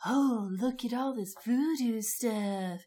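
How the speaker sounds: slow, drawn-out mock-ominous voice; mock spooky. Podcaster mocking the show's voodoo vibe, mock-ominous. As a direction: draw it out as if showing off creepy props. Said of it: "trying to be like".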